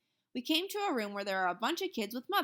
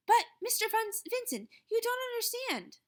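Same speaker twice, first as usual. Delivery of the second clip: calling after; 13 semitones higher than usual. A narrator's reading. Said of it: "She called".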